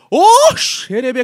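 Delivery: slow, drawn-out mock tongues. Mimicking a worshipper in tongues, slow mock chant. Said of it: "o cara tá".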